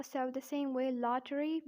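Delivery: ordinary talk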